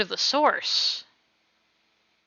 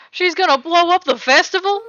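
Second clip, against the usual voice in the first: alarmed; 7 semitones higher, 8 dB louder than usual. Acted. Alarmed realization.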